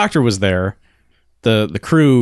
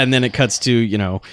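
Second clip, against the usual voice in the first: ordinary talk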